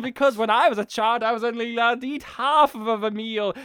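high, emphatic cartoon villain; Doctor Doofenshmirtz. Villain as Thanos explaining his plan, high proclaiming voice. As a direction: proclaim it as a mad scientist. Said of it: "and he's just like".

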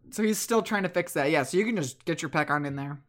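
ordinary talk

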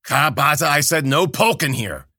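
loud, sharp reprimand; angry old crone raising her voice; scolding crone. A narrator's reading. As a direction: snap at him for the smell.

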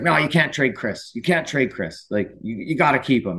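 lightly voiced Vince Vaughn insisting they keep him; Vince Vaughn impression. Actor pleading with the GM not to trade Chris, lightly voiced Vince Vaughn. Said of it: "as Vince Vaughn does".

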